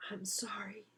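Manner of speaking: soft whispered sorry; a hushed heartfelt apology. Acted. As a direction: breathe a sincere sorry. Florrie's sincere apology.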